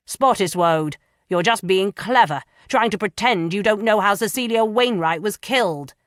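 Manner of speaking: loud, sneering accusation; loud, scornful accusing voice; accusing magistrate. Acted. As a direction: jab the words at the prisoner.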